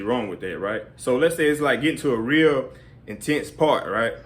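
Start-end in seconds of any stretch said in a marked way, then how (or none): none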